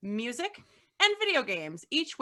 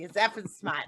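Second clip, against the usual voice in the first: Boston accent. Real, not acted. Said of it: "Correcting the Boston girl on her accent".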